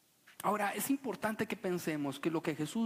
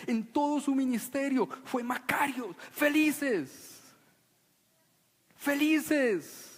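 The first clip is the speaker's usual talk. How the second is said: high and loud, felices sung out with delight; joyful proclamation; 7 semitones higher, 4 dB louder, slower than usual. Shown as a demonstration. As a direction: announce it like good news. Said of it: "y esto es muy importante".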